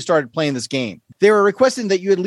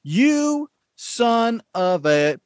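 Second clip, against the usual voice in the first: mock cursing; 3 semitones higher, much slower than usual. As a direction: stretch each word in playful disbelief, pausing before the last. Host reacts to rival's quick win.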